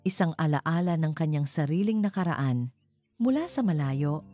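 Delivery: usual speaking voice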